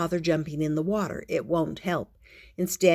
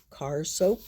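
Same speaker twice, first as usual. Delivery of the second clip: ordinary delivery, nothing marked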